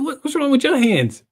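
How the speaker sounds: high-pitched mock exasperation; retold squabble, voice shooting up in disbelief; incredulous demand. Acted quarrel with girlfriend.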